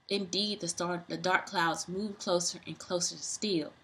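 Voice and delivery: plain reading voice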